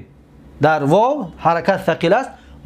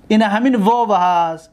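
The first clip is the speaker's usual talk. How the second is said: ordinary talk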